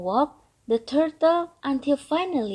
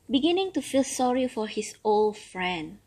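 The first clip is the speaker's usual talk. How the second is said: usual speaking voice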